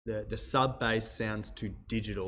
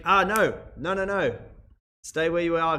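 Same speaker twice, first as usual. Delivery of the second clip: loud call back; 5 semitones higher, 6 dB louder than usual. Real, not acted. Calling viewers back before leaving.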